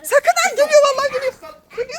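high, fast laughing cry; laughing shriek. Real, not acted. Mother laughing as son comes with hose. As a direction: squeal through laughter.

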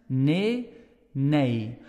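"Nee" flat then "nee" rounded; contrasting nee. Shown as a demonstration. Teacher repeats both nees for comparison. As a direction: say both takes back to back. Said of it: "You hear the difference?".

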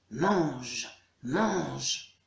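low growled demon chant; drawn-out monster grumble while waiting; growling demons. A narrator's reading. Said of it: "claquant la mâchoire et grognant".

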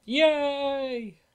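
high, drawn-out yay; excited cheer. Real, not acted. Gamer cheering a free power up, excited cheer.

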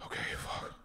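breathless whisper to himself. Retold or imitated. Counting his remaining years, whispering to himself. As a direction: barely voice it, stunned. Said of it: "I was just like".

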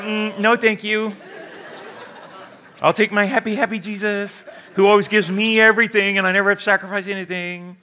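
mock-sweet, laughing refusal; playful refusal. Preacher mocking believers who refuse God, laughing mock-sweet. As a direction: laugh through it like a spoiled kid. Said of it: "you're like".

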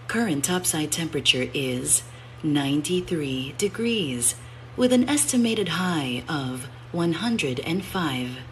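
even, measured transit announcer read; calm station announcer voice; automated announcement. Shown as a demonstration. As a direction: deliver it like a recorded train PA.

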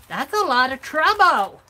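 exasperated exclamation. Real, not acted. Exclaims over her messy work.

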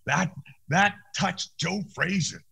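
high, slow, wobbly knocked-out mumble; hammed-up knocked-out boxer; mock dazed voice. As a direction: play punch-drunk after the tap. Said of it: "I went".

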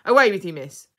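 loud dismissive command; sharp order, dropping off on the last word; gruff pirate order. A narrator's reading. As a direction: send her away with a pirate's shout. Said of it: "he ordered".

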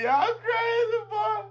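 high-pitched laughing exclamation. Real, not acted. Laughing at the crowd video, high-pitched and breaking. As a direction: crack up at the video.

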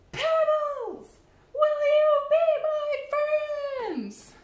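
high, loud call to the pebbles. Acted. Fish asking pebbles again, loudly. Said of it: "I say".

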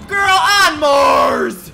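slow, high, loud exclamation; drawn-out shout. Real, not acted. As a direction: stretch the shout out to the sky. Reacting to gift sub.

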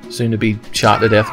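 ordinary talk

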